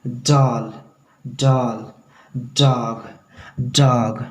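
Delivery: डॉल, डॉग begun with tongue at the teeth; soft English D. Shown as a demonstration. Teacher drills example words, soft D onset. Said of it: "तो एक soft sound आएगी".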